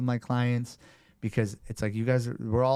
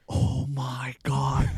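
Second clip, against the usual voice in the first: stunned; 3 semitones higher, much slower than usual. Retold or imitated. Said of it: "and I'm like".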